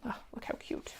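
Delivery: whispered aside. Real, not acted. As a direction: coo it to yourself over the fabric. Admiring her sewing aside.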